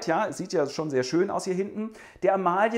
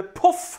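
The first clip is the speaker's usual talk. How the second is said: magic puff; 4 dB louder than usual. Acted. Fairy throws magic pearl.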